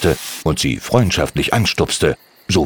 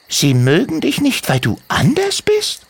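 kobold Timmy. Acted. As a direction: gently contradict her as a tiny goblin. Adult asks in disbelief.

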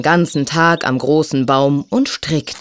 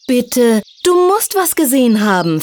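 high, insistent begging; pleading; 7 semitones higher than usual. A narrator's reading. Silja begs the snake to remember, pleading. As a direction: beg with a raised, urgent voice. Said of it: "flehte Silja".

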